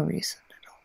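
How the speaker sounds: unvoiced whisper. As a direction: breathe it out close.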